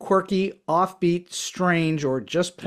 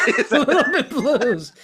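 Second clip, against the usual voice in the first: laughing, higher echo of the quote; 7 semitones higher than usual. Real, not acted. Host echoing the guest's funny quote, laughing. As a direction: repeat the line through a laugh.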